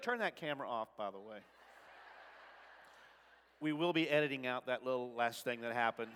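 ordinary delivery

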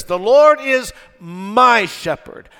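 "my" stretched long, high and loud; stress on MY. Shown as a demonstration. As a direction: lean slowly and hard on the word "my". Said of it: "with the emphasis on my".

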